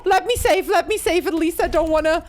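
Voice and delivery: high, loud frightened pleading with a laugh; panicked shriek. Real, not acted. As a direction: yelp and beg while being chased. Chased, begging to reach save.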